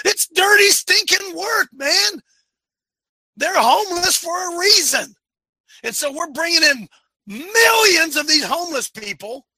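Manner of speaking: worked-up, high-pitched preacher yelling; real impassioned rant at the room. Real, not acted. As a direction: yell it out, pitched well up. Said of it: "that's 'cause I'm yelling at you".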